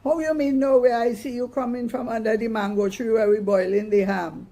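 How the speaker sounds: high, loud suspicious Mama; concerned scolding. Acted. Mama grills Mikey about the mango tree, high and loud. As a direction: demand an answer. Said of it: "growing more concerned".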